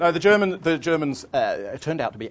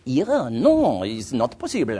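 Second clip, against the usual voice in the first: put-on French accent; 4 dB louder, slower than usual. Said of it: "it came clear that he was saying".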